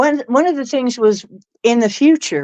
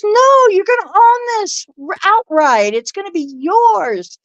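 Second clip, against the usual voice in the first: high, insistent no; mock excited reader; emphatic correction; 9 semitones higher than usual. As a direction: correct herself with certainty. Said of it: "she goes".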